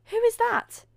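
very high, loud astonishment; gasp-worthy newcomer, ask in amazement; amazed question. A narrator's reading. Guests amazed at mysterious newcomer.

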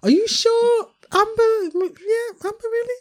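high, far-off doubtful voice; mocking Mark. Friend mimics Mark doubting about Amber, high and incredulous. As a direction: squeak it out in disbelief as him. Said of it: "You're like".

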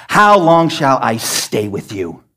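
slow, drawn-out weary rebuke; annoyed Jesus. Retold or imitated. Preacher voicing Jesus tired of the disciples, slow and annoyed. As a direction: let the exasperation drag. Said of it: "can you sense the annoyance of Jesus".

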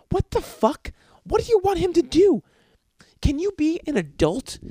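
comic exasperated shout at the mom; exasperated yell. Acted. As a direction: throw your hands up in disbelief. Ranting at the mom.

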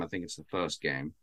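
faint and distant, off mic; leaning away from the microphone; phone mic far away. Real, not acted. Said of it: "sounds like you're a little far from the mic".

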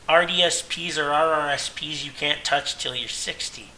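normal talking voice

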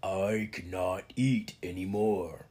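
slow rumbling half-troll voice. A narrator's reading. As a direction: rumble it out slowly like a big troll. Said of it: "X rumbled".

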